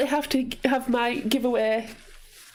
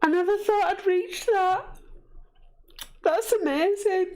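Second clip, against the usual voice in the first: high, tearful voice, overwhelmed with joy; crying with gratitude as the words come; real happy crying, voice raised high; happy tears; 7 semitones higher than usual. Real, not acted. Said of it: "Happy tears".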